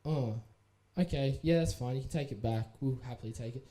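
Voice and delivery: a hint of the bored staff turning him down; lightly voiced shop clerk. Shop staff refusing a refund.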